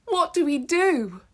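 high, loud, panicked pleading; imploring plea. Acted. Ruth imploring the man for instructions, panicked high plea. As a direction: beg him for what to do. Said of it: "imploring him for instruction".

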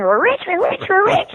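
high, ecstatic screaming of we're rich; excited screaming. Retold or imitated. Daughter screaming with joy over the lottery win, high ecstatic shriek. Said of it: "she started screaming".

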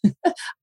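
short high-pitched laugh; amused laugh. Real, not acted. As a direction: laugh at your own slip. Laughs at own botched name.

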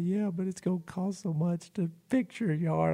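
high, soft imitation of a worried girl; worried young woman. Voicing the upset girl's worry.

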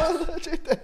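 high, excited echo call; announcer echo; game-show shout. Acted. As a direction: join in the hype. Echoing the announcement.

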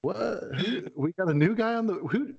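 high, puzzled questioning; mock bafflement. Players sitting on the bench hearing an unknown name, mock bafflement. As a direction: act out baffled teammates.

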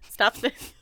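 ordinary talk, nothing marked

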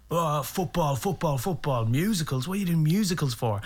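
high, put-on scoffing voice; mocking football fans. Football lovers question his musicals, mocking caricature.

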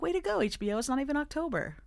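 high, cheering call-out; mock cheer. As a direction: cheer the network on. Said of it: "I was like".

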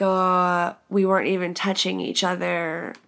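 normal talking voice

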